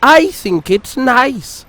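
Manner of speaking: a sweet little engine being kind; friendly Peter Sam cheering up his grumpy friend. A narrator's reading. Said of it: "said Peter Sam".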